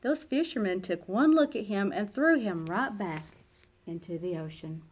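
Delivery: ordinary delivery, nothing marked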